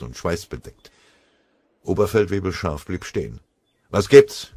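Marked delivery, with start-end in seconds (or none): 3.9-4.5 sharp, much louder and higher snap; irritated. Acted. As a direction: bark it out, annoyed at the interruption. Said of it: "fragte er gereizt".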